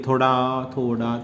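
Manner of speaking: ordinary talk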